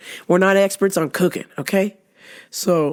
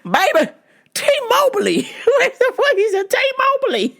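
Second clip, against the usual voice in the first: laughing disbelief. Real, not acted. Laughing at the absurd reveal.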